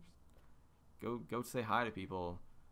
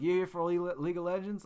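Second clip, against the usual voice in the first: higher-pitched, clumsy Aussie imitation; bad Australian accent; 6 semitones higher, 4 dB louder than usual. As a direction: have another shaky go at an Australian accent. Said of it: "Australian accent ever".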